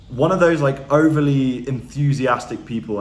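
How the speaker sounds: lightly mock the pumped-up salesman; mildly hammed-up enthusiastic seller; hypey salesman. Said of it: "sounding like a hypey salesman".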